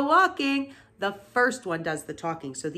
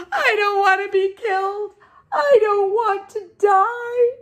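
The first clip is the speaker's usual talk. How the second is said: panicked scream; 14 semitones higher, 9 dB louder than usual. A narrator's reading. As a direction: squeal in desperate panic. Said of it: "screamed Wilbur, racing up and down".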